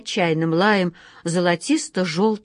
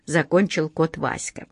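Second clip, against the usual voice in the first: plain reading voice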